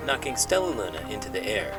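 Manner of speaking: ordinary delivery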